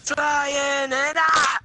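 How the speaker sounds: drawn-out thrilled yell while flying; slow gleeful shout; excited shout. Real, not acted. Flying through the air in game.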